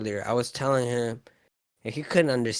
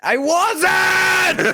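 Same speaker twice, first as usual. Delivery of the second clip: high, full-volume indignant yell; comically loud, shouted protest; screamed denial; 8 dB louder than usual. Acted. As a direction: deny it by screaming it. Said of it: "but you were screaming".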